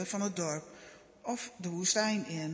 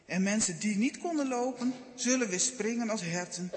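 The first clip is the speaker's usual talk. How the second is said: plain reading voice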